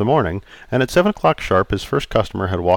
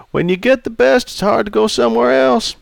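put-on raised, jovial old customer; 12 semitones higher than usual. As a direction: play Charlie, teasing. Charlie flatters.

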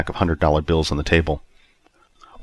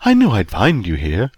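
high, louder, swooping seductress voice; husky, enticing woman; 7 semitones higher, 3 dB louder, slower than usual.

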